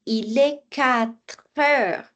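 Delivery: "quatre heures" slowly joined as "qua-treures"; slow, drawn-out "Il est quatre heures", tr carried onto "heures"; linked consonant. Shown as a demonstration. Teacher shows tr joining heure, slowly linked. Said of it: "Le tr va venir s'unir avec heure".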